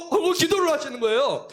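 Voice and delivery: ordinary delivery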